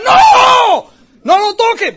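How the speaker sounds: high loud shouted no; alarmed shout. Retold or imitated. Bandmates yelling at him not to touch the sound gear, high and loud. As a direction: yell to stop him touching it.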